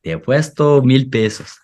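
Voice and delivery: slow, drawn-out Spanish with a high pitch; Spanish bet phrase. Shown as a demonstration. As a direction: say te apuesto mil pesos slowly, carefully. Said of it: "No, no, no, no".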